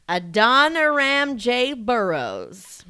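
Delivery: put-on slow drawn-out Southern accent. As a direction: savor the name in a Southern accent. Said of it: "say that with a Southern accent".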